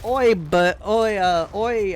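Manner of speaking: a hint of a mock British lout; sloppy British lout calling out oi; lightly hammed-up British oi. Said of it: "what do they call women in England".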